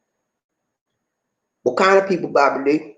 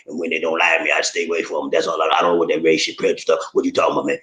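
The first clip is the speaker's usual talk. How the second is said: low, dragging dumb-guy voice, much lower; clueless guy voice; 12 semitones lower, much faster than usual. Acted. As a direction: play a clueless man who just doesn't get it. Plays a clueless guy character.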